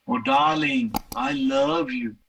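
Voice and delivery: swoon, then linger on it; drawn-out take. Shown as a demonstration. Telling his girlfriend he loves her, slow and swooning. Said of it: "six different attempts I made".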